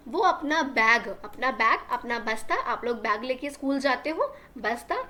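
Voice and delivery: ordinary delivery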